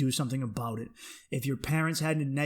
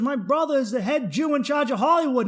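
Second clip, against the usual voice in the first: loud, high, proud proclamation; imitated boast; 11 semitones higher, 7 dB louder than usual. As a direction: announce it big, in a raised voice. Acted man boasting of brother.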